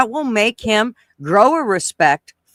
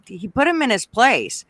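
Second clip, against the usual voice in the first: ordinary talk, nothing marked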